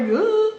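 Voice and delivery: exasperated groan. Retold or imitated. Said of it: "And I was like".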